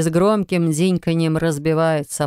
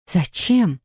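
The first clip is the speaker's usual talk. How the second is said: gasped; 2 semitones higher than usual. A narrator's reading. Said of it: "охает она".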